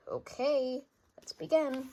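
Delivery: ordinary delivery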